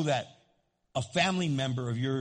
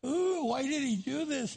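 retold frustration; 9 semitones higher than usual. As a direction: mimic her venting at God in a high voice. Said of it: "you gotta be angry at God".

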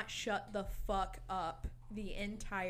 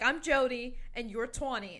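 playful put-on New York accent, louder; mock New York accent; 3 semitones higher, 8 dB louder than usual. Friend introducing herself in fake New York accent, hammed up. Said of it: "talk in that accent".

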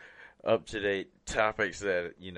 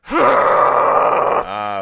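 loud voiced metal scream; false chord scream; 14 dB louder than usual. Shown as a demonstration. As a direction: add voice to the exasperated sigh. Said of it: "And then we add voice to it".

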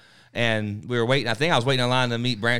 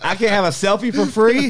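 higher, louder, incredulous; mock outrage; 8 semitones higher, 8 dB louder than usual. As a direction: protest in disbelief. Mock outrage over selfie price.

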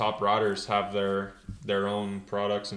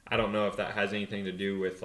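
ordinary talk

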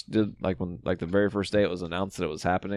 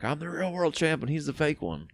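high, softer put-on brag; lightly hammed-up title claim, pitched up; mock champion boast; 8 semitones higher, 5 dB quieter than usual. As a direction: imitate a wrestler's boast in a high voice. Imitating a wrestler's title boast.

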